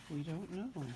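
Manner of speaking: plain reading voice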